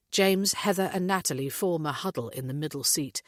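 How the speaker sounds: ordinary delivery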